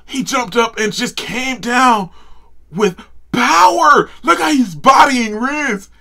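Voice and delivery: high, loud, slow hype shout; excited yelling. Real, not acted. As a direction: drag every word out in hype. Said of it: "If I'm yelling, I apologize".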